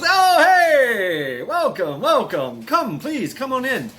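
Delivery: high, loud booming welcome from the throne; a giant king hamming up his hospitality; giant host greeting. Retold or imitated. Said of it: "He goes".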